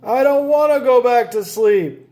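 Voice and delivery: high, loud whiny protest; loud kid protest; crying out. A narrator's reading. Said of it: "cried Froggy".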